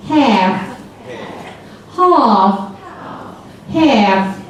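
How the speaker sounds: American half with a short flat vowel; now say half like Donkey. Shown as a demonstration. Teacher leading class in Donkey's American half. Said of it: "now we're gonna be donkey".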